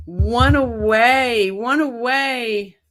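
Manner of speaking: long drawn-out, swooping sing-song; slow celebratory chant; excited sing-song. Real, not acted. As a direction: stretch each word out in gleeful anticipation. One subscriber short of a thousand.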